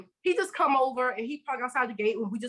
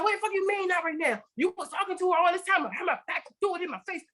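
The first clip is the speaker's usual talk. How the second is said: high-pitched mock tirade, imitating the angry wife; imitating furious wife; 9 semitones higher than usual. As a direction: rant it high and accusing, playing the wife. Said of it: "the wife started going off".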